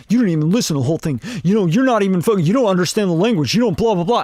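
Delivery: rapid-fire imitation of an objector; mock complaining. Coach mimics rappers protecting their ego, fast mock protest. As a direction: rattle off the excuses fast, dismissively. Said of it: "If you take it as, like".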